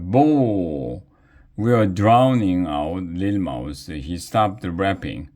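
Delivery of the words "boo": drawn-out falling boo; mild crowd boo. A narrator's reading. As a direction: jeer like a heckler. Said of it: "Everybody started booing".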